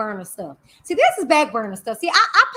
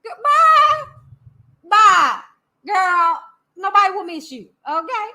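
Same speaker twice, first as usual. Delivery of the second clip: high, loud, drawn-out mocking send-off; mock departure announcement; 6 semitones higher, 5 dB louder than usual. Streamer mocks viewer announcing she's leaving, theatrical announcement. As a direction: announce the exit with theatrical mockery. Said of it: "you gotta make an announcement".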